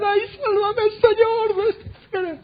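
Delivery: high-pitched acted wail for rescue; mock screaming plea. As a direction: cry out in exaggerated, high-pitched panic. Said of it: "¿Por qué no gritaban".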